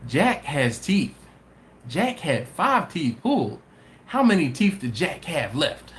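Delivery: spelled out slowly like a math lesson; walk through it slowly as if teaching kids; slow teacher explanation. Shown as a demonstration. Host patiently spelling out the math to Jack, slow. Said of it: "Basic math".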